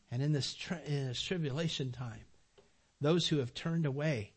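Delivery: ordinary delivery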